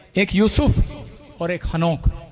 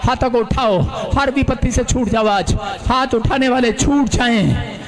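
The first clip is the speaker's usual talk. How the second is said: rally the crowd with raised voice; booming political rally speech; 4 semitones higher than usual. Real, not acted. Climax, calling congregation loudly. (ज़ोरदार जोशीला आह्वान)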